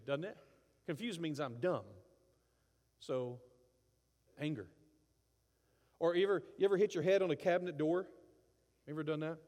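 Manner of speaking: normal talking voice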